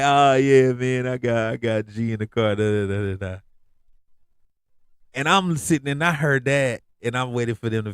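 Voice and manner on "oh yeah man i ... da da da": put-on cool voice. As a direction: ham up a guy faking swagger for a listener. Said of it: "you don't even talk like this".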